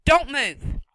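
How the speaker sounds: loud, sharp command; sharp order. Acted. Woman orders the boy to stay still, sharply. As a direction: stop him cold.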